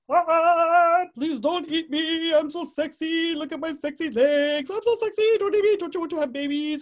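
high, loud, hammed-up begging; pleading male tarantula. Male tarantula dancing so she won't eat him, hammed-up pleading. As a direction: plead frantically in a high comic voice. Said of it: "So he's like".

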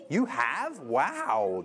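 ordinary talk

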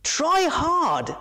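high urging voice; Toto urging. Acted. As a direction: coax him on from the bank.

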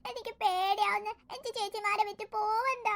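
slow, soft crying voice; tearful. Acted. Frightened boy telling his sisters he'll be sent away, tearful. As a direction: sob out the fear of leaving. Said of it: "അപ്പുകുട്ടാ, നീ കരയണ്ട".

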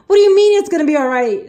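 fast, high incredulous challenge; exasperated mock outburst at nobody; panicked, frustrated. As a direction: fire the question back in disbelief. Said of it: "I was just panicking, frustrated".

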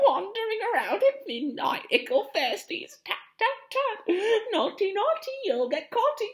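high, loud mischievous sing-song taunt; cackling poltergeist. Acted. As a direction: cackle like a gleeful sprite.

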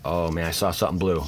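ordinary talk

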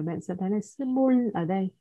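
ordinary delivery

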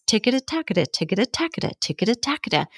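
rapid "ticketa-tacketa" drill; fast tongue twister. Shown as a demonstration. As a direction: rattle it off quickly and cleanly. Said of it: "help yourself not trip over your tongue".

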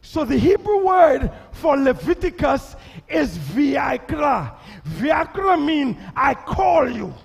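loud, slow preaching. Acted. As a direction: proclaim it to the church. Preaching at full force.

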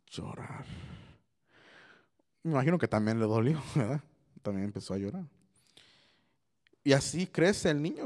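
ordinary talk

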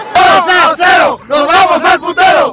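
booming celebratory shout; ringmaster. Real, not acted. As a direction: roar it out like a stadium chant.

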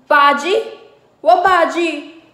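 high, loud furious roar. Acted. Husband roaring at wife over the bhaji, loud. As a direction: roar the question back at her. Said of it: "roared Gurudeva".

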